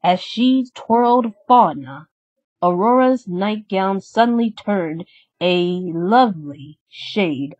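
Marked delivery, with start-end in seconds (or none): none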